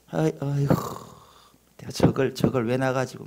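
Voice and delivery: a hint of a resentful parent; muttered contempt. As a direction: sigh at the child with cold disgust. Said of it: "막 이렇게 가는 거예요".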